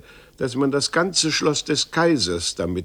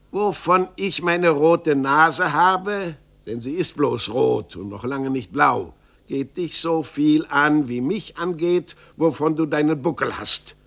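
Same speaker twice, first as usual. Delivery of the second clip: put-on old carpenter huffing with offended pride; indignant carpenter; 4 semitones higher, 6 dB louder than usual. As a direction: bristle and throw it back at him. Carpenter retorts in story.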